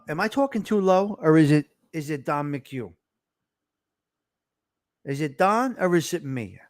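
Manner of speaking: usual speaking voice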